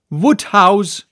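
urgent loud call; cried. Acted. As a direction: shout for the landlady. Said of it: "cried I".

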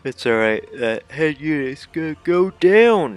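loud, high words stretched by a yawn. Real, not acted. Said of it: "I didn't mean to yawn".

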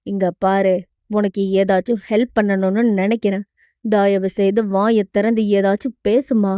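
low-voiced hero urging her to speak; hero, coaxing. (கதாநாயகனின் தாழ்ந்த, கெஞ்சும் குரல்)

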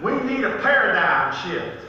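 hammed-up Southern drawl, stretched out; southern accent. Preacher quotes Paul for laughs, mock southern accent. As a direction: put on a broad, comic southern accent. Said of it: "with a southern accent".

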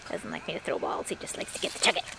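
whispered aside. Real, not acted. Aside while dog plays.

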